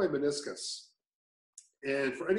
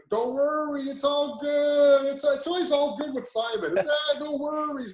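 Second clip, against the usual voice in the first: put-on Simon's accent; 12 semitones higher, 5 dB louder than usual. Coach reassuring an injured client, in a put-on accent. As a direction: do a singsong, exaggerated take on a coach's accent. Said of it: "In that accent?".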